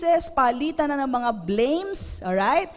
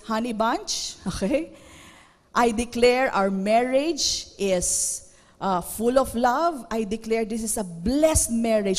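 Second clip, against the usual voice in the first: calm declaration; 3 semitones lower than usual. Shown as a demonstration. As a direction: bless the marriage in a gentle voice. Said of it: "The better way to do is".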